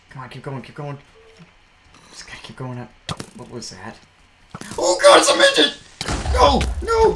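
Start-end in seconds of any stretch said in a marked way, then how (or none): 4.8-7.2 shrieking high in panic; panicked yelp; terrified scream. Real, not acted. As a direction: scream in real fright. Attacked by mobs, screaming.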